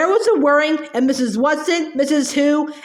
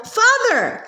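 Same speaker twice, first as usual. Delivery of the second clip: sudden yell, pitched high; a boy yelling across the lawn to his father; 8 semitones higher than usual. A narrator's reading. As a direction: call out to him, much higher. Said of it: "Sandy suddenly yelled".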